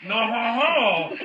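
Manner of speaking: high, loud hammed-up wail; mock wail. A narrator's reading. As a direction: wail in comic despair. Zimmy wails as he is hit.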